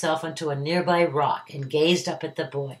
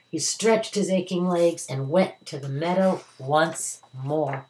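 ordinary delivery

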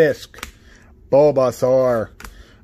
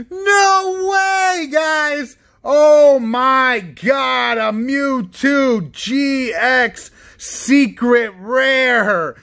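high, loud whooping and drawn-out words; real ecstatic disbelief; 7 semitones higher, 3 dB louder, much slower than usual. Real, not acted. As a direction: cry out in amazement, stretching the words. Stunned by pulling a rare card.

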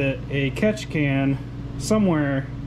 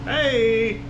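sudden happy yell when the fans kick in; excited shout; 7 semitones higher than usual. Real, not acted. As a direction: call it out, pleased and surprised. Fans finally switch on.

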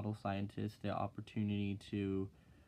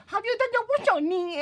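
much higher and louder squeaky voice; Chinese lady impression; 10 dB louder than usual. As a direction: imitate the little teacher, shrill and loud. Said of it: "this freaking little Chinese lady comes up to me and is like".

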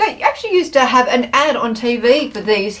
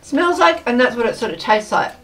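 crunching snack between words; talking while chewing; 2 semitones lower than usual. Real, not acted. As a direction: mouth partly full. Seen 2: Woman raises crisp to mouth at +0.4, keeps nibbling while talking.

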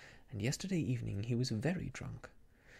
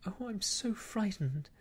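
higher, breathless frightened gasp; panting fright; 7 semitones higher than usual. A narrator's reading. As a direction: pant it out in fear. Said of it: "I panted".

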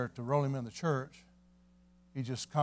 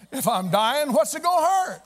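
pleading patient; 12 semitones higher, 7 dB louder than usual. Retold or imitated. Sick man pleads his case.

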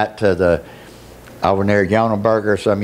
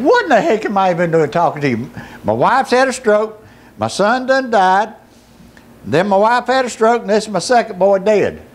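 raised, high-pitched protest; angry complaint; 9 semitones higher than usual. Retold or imitated. Bereaved father questioning God, angry complaint. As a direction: argue bitterly with God, voice pitched up.

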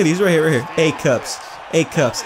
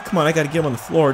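ordinary delivery

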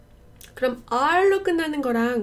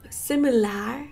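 SIMILAR Jamaican-style, ending in "lar"; Jamaican English; much slower than usual. Retold or imitated. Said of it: "그건 Jamaican English".

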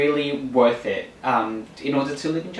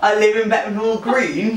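higher-pitched mock Cockney; exaggerated Cockney, pitched up; Cockney accent; 7 semitones higher, 3 dB louder than usual. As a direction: put on an East London Cockney voice. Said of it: "that accent".

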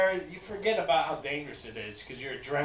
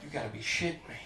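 ordinary delivery

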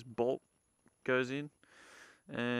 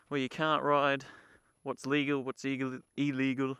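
normal talking voice

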